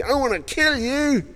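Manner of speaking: high, slow mock menace; a hint of a threatening mob; mock threat. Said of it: "when people say to you".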